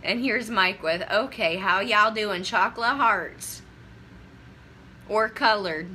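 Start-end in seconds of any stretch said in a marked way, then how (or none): 1.1-3.6 put-on a light Southern accent, hearts drawn out slow; lightly put-on Southern drawl for Mike. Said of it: "the word colored and a Southern accent". 5.1-5.9 a light put-on Southern accent on colored. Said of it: "the word colored and a Southern accent".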